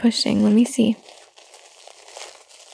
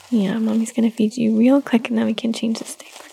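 whispering. Real, not acted. Whispering while settling baby.

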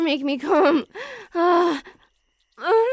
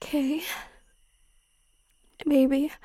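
ordinary delivery

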